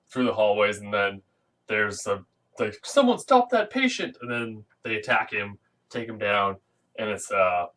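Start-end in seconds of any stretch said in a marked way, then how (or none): 2.8-4.2 calling out. Retold or imitated. Staff calling out in hospital.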